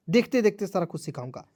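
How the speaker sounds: ordinary talk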